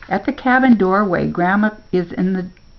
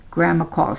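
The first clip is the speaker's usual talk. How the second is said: ordinary delivery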